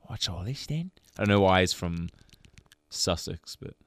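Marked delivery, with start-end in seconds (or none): 0.0-0.9 quiet English bobby voice. Retold or imitated. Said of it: "comes out of the corner and goes".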